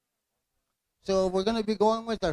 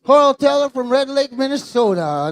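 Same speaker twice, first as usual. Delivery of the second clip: louder, announced clearly into the mic; 4 semitones higher, 9 dB louder than usual. Real, not acted. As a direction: call the name out so the whole arena hears. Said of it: "Say it again".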